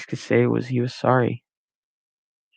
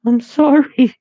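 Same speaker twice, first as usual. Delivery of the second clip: much higher, louder anguished cry; 8 dB louder, slower than usual. A narrator's reading. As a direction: wail the apology. Max cries out.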